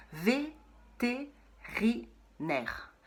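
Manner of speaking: vétérinaire stretched slowly, syllable by syllable. Shown as a demonstration. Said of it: "celui-là, il est dur". (vétérinaire scandé très lentement)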